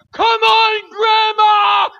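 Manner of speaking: high, loud shout; frantic high shout at grandma; urgent shout. Retold or imitated. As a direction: yell at her to hurry. Urging old lady, lion near.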